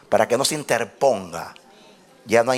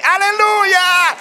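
grito de júbilo muy agudo y fuerte; prédica exaltada; 5 dB louder, much slower than usual. Real, not acted. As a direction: exhorta a la iglesia gritando, lento y alargado. (prédica a gritos, con júbilo)

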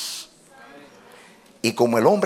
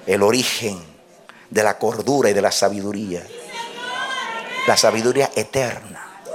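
low, hushed sermon voice in short phrases; grave, measured declaration with pauses; solemn preaching; 8 semitones lower, 3 dB quieter than usual. Real, not acted. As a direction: lower the voice and let each phrase settle.

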